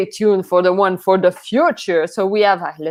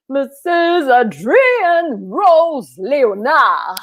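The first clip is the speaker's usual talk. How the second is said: drawn-out host welcome; 5 semitones higher, much slower than usual. Acted. Welcoming guest onto the screen.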